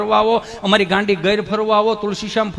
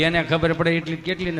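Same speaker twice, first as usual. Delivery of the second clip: ordinary talk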